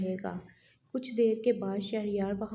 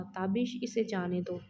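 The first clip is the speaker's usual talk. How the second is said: plain reading voice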